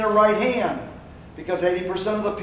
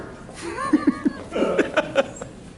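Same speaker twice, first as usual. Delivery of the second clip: high laugh; 3 dB quieter than usual. Acted. Mocking stuck customers.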